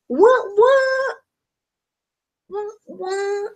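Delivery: very high squeak, then faint; squealing what. Real, not acted. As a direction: squeal with glee. Mock squeal.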